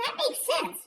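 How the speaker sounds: lightly voiced child agreeing; imitating a kid. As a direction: play the impressed little nephew. Nephew voiced in story.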